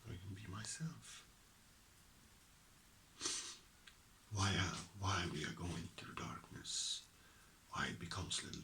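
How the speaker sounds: soft intimate whisper; ASMR-like hush. Real, not acted. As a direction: lean in and whisper gently. Held whisper passage.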